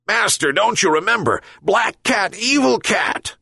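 high, angry dog voice; angry yelp. Acted. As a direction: bark it at the kitten. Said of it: "yelped the dog even more angrily".

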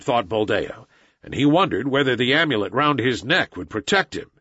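ordinary delivery, nothing marked

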